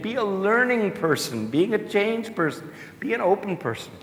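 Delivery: a hint of a carefree guru; quoting the author. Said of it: "But he actually says".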